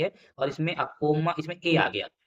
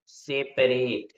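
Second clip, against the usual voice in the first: "Separate" stretched very slowly; slow careful word; 3 semitones lower, much slower than usual. Shown as a demonstration. Teacher to students, stretching separate very slowly. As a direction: draw out "separate" syllable by syllable. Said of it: "separate नहीं होता है".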